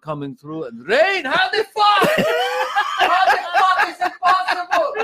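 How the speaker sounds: hammed-up weatherman exploding in disbelief; angry Armenian meteorologist. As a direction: read the forecast, then lose it. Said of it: "What would he say?".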